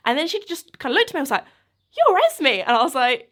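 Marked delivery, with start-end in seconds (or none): 2.0-2.5 high, loud imitation of a startled fan; surprised recognition. Fan recognising the YouTuber at the airport, surprised. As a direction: squeal it as a disbelieving question.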